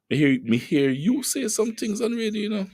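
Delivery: high, playful Jamaican patois voice. Retold or imitated. Host quoting a studio colleague, in Jamaican patois. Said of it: "I wish I could talk patois like her".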